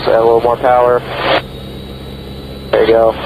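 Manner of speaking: usual speaking voice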